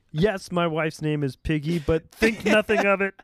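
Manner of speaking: a hammed-up Kermit voice, rising; Kermit-voiced counselor. Counselor who sounds like Kermit talks about his wife. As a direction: play the counselor as Kermit the Frog. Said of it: "sounded like Kermit the Frog".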